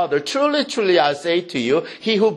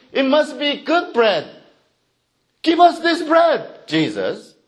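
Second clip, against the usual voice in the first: eager demand; 7 semitones higher than usual. Acted. Crowd demanding bread from Jesus.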